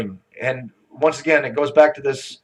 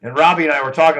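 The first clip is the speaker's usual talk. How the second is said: usual speaking voice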